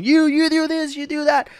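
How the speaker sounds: panicked divers shouting orders at each other, mock high yell. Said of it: "Everybody starts yelling".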